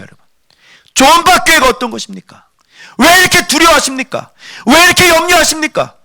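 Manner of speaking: high, insistent sermon voice; impassioned preacher at high pitch; forceful preaching. Real, not acted. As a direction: drive the questions home to the congregation. Preacher exhorts congregation at full force.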